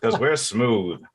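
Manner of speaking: hammy vintage radio announcer; old-time radio voice. Acted. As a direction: sell it like a fifties radio ad. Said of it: "do a old timey radio voice".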